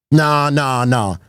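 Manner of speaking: normal talking voice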